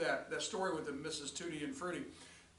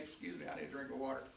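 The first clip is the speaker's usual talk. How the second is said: usual speaking voice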